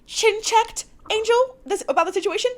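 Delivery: high, sing-song fake-innocent girl voice; mocking Giselle. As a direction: play the messy friend spilling tea, high. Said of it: "and Giselle's like".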